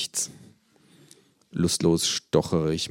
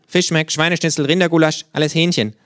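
higher, louder cheeky kangaroo, final "alles Hähnchen" falling firmly; 9 semitones higher, 6 dB louder than usual. Acted. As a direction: state "alles Hähnchen" as settled fact, pitched up and loud. Kangaroo character voice.

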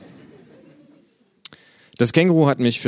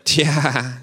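higher, knowing "Tja"; meaningful kangaroo; 7 semitones higher, 4 dB louder than usual. Acted. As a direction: let "Tja" hang, loaded with meaning. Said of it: "sagt das Känguru vielsagend".

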